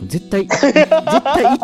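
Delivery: laughing, high and loud. Real, not acted. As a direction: burst out laughing mid-word. Laughing retelling.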